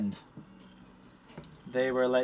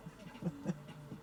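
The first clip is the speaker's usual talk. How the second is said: breathy silent laugh; 12 dB quieter than usual. Real, not acted. Laughs at the chat.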